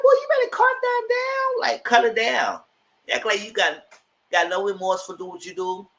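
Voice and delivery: high, put-on scolding voice; sassy scolding. Speaker telling Keandre off, high sassy scolding. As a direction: tell him off like an auntie.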